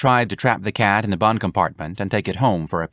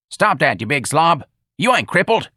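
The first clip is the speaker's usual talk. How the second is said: raised, higher voice barking at a lazy slob; irritated boss yelling at his worker; angry yell; 7 semitones higher, 3 dB louder than usual. Acted. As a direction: snap at him, high and loud. Mister Clyde yelling at Ignatius.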